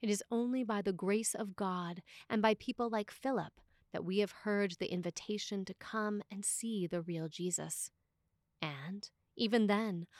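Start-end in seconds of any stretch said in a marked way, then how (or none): none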